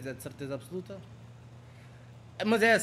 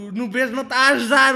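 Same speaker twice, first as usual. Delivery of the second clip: loud frustrated complaint; 7 semitones higher, 12 dB louder, faster than usual. Real, not acted. Complaining loudly.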